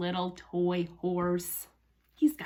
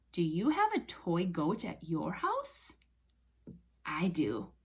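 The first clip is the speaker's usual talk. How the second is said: normal talking voice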